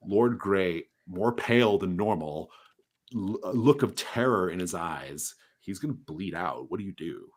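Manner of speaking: normal talking voice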